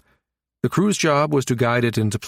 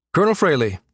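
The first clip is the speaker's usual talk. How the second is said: higher, curt greeting with annoyance; barely civil; 6 semitones higher, faster than usual. A narrator's reading. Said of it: "in a tone that could barely be called civil".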